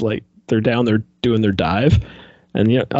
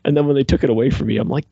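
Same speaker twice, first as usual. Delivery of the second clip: ordinary delivery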